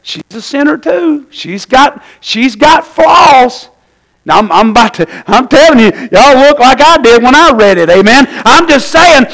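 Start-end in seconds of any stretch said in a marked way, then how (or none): 2.2-3.7 flaws stretched long and shouted; drawn-out stress. Real, not acted. Preacher insisting Bathsheba is a sinner, slow shout. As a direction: lean hard and slow on "flaws".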